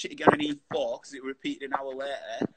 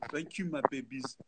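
Phoebe with a cold; 6 dB quieter than usual. Retold or imitated. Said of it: "when Phoebe had the cold and she went".

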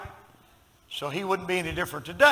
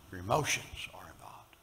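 soft whisper, lower; preacher whispering a point to the church; whispered aside; 8 semitones lower, 8 dB quieter than usual. Acted. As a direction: drop to a hush to draw them in.

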